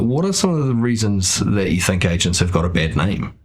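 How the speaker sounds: normal talking voice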